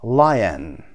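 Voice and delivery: Lion with LI hit and the end falling; first-syllable stress. Shown as a demonstration. Teacher marking the stressed syllable for learners, LI stressed in Lion. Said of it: "قسمت اول".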